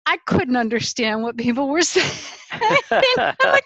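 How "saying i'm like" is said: ordinary delivery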